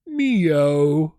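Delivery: drawn-out, high cat's meow, loud and plaintive; long mournful "meow" in imitation of a pet; sad meow. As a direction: imitate a heartbroken cat meowing. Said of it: "The sad meow".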